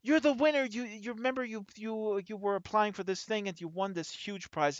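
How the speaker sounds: excited radio host, starting very high then settling; mock host telling a caller he won. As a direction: break the news like a giddy prize host. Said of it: "the radio host says".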